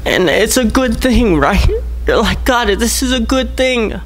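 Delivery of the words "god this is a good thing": hopeful plea. Acted. Voicing a hopeful believer's prayer.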